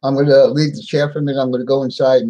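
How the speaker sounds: ordinary talk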